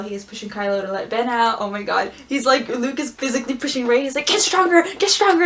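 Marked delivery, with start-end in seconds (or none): 4.3-5.4 high, loud comic urging; hammed-up pushy yelling; mock drill yell. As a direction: shout it like a pretend pushy trainer. Luke pushing Rey in training.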